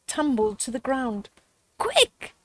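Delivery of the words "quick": high urgent call; urgent gasp. Acted. Brother mouse urging his sister, high urgent gasp. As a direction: gasp it out in a hurry. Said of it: "Sam gasped".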